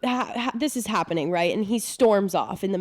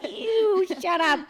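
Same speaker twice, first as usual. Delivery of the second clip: pretend protective friend; 12 semitones higher, much slower than usual. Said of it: "two of her would have been like".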